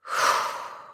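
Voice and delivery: long airy exhale; deep breath out. Shown as a demonstration. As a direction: breathe out slowly to release tension. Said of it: "take a breath".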